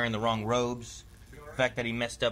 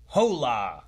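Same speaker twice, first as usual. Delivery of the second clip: flat American "Hola!" with hard H and American vowels; Americanized Spanish; 6 dB louder than usual. Shown as a demonstration. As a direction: say "Hola!" like a tourist from the US. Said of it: "like, Americanized".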